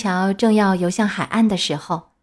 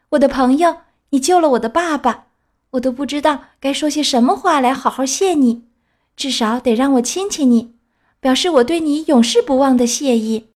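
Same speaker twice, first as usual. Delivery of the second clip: raised, heartfelt thanks; grateful; 6 semitones higher, 4 dB louder than usual. A narrator's reading. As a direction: thank him with all your heart. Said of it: "用感激的声音对他说".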